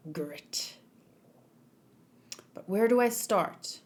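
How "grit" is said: a hushed, breathy "Grit."; one whispered word to steel himself; whispered resolve. Acted. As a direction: whisper it through clenched resolve. Suppressing emotions, internal monologue.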